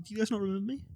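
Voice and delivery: ordinary delivery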